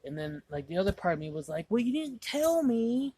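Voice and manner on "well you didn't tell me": slow, high, loud defensive whine; acted-out protest. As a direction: drag out a mock objection, pitched way up.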